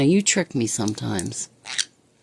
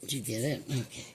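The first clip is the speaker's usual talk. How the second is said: normal talking voice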